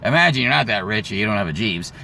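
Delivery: normal talking voice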